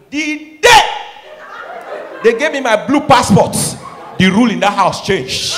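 triumphant. Real, not acted. Triumphant passport moment.

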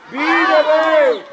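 long drawn-out, high, loud praise; cheering shout. Real, not acted. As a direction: stretch the cheer out across the pitch. Cheering good play. (laudă strigată prelung)

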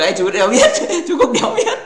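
words breaking into high laughter; laughing. Real, not acted. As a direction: laugh through the line, voice jumping up high. Laughing reading a comment. (vừa nói vừa cười ré lên)

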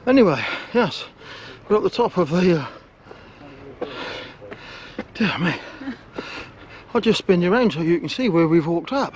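breathless and wheezing after steep steps; wheezy voice. Real, not acted. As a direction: gasp the words out between breaths. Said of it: "I can't get my breath".

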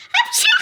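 imitating mom's sneeze, high and loud; squeaky fake sneeze, burst out; sneezing. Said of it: "Annemin hapşuruşu". (annesinin hapşırığını taklit eden yüksek sesli hapşırık)

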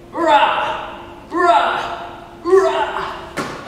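playful high mock roar; lion roar. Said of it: "lion press ups".

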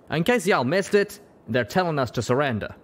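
lightly voiced southern stallion, dry. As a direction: point it out with a wry southern lilt. Southern pegasus points out surrender demand.

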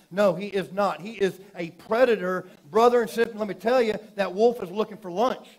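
loud, high preacher's warning; full-force preaching. Acted. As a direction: hammer the warning home to the church.

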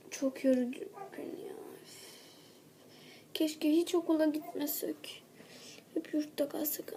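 weary grumbling whisper. Acted. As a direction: sigh it out in a whisper. (yorgun fısıltı)

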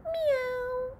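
high soft meow; cat meow. Acted. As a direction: mew like a small cat. A cat arrives.

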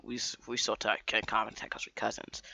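quick whispered side remark. Real, not acted. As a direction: drop to a whisper for the aside. Aside about cousins.

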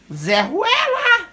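very high, slow squawk of a parrot. Pretend. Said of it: "Fala, fala, louro".

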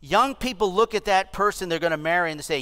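ordinary talk, nothing marked

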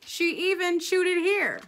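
high, loud incredulous storyteller; loud, amused narrator punch; comic escalation. Acted. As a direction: top off the list with big emphasis. Builds comic list in narration.

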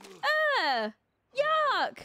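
high, loud grossed-out ugh; disgusted. Real, not acted. Gamer watching her sim about to puke, disgusted yuck. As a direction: recoil from something gross.

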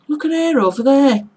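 loud, high, broad Middlesbrough; Middlesbrough accent. Retold or imitated. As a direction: call it out in exaggerated Middlesbrough dialect. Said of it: "So you'd hear people say".

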